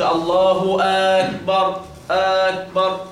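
"أكبر" said as aakbar with a long first a; added alif. Shown as a demonstration. Teacher warning against extra alif, long held aa. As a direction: stretch the opening a of "أكبر". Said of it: "وما تزودش ألف بعد الهمزة، ما تقولش".

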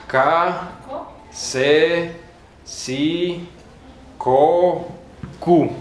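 "K, C, si, ko, ku" spelled out carefully, syllable by syllable; Spanish C sounds. Shown as a demonstration. Teacher showing how the Spanish C changes before each vowel. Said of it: "puede variar dependiendo qué vocal".

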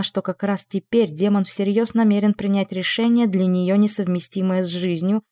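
ordinary delivery, nothing marked

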